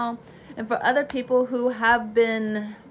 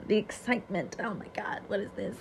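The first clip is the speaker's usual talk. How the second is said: awed whisper; 8 dB quieter than usual. Real, not acted. Hushed awe.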